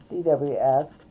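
usual speaking voice

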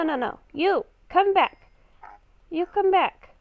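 ordinary talk

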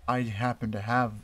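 usual speaking voice

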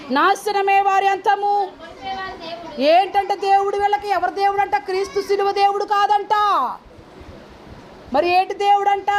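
high, sustained pulpit proclamation; full-force preaching. Real, not acted. Preacher warning the congregation of destruction, high forceful preaching. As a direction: ring out the verse, then challenge them.

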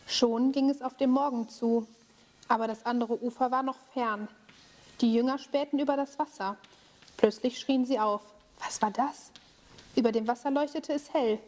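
calm storytelling voice; story narration for children. A narrator's reading. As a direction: tell the tale to kids gently. Bible story told to children.

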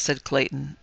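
ordinary delivery

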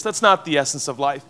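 normal talking voice

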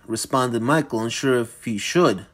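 ordinary delivery

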